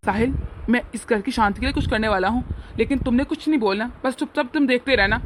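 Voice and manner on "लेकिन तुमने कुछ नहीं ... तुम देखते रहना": ordinary talk, nothing marked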